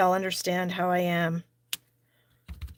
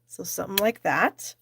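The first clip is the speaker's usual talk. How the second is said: ordinary talk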